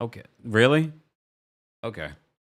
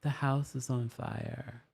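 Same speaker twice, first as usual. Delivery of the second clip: softer, deadpan calm; mock calm quiet; 3 semitones higher, 9 dB quieter, slower than usual. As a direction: say it flat and softly as if nothing's wrong. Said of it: "she wouldn't yell".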